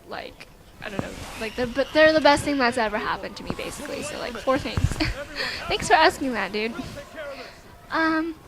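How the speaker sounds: ordinary talk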